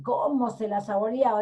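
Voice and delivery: ordinary delivery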